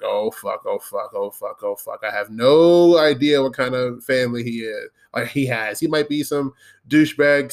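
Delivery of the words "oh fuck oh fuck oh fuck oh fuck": panicked muttering. Retold or imitated. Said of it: "I'm like".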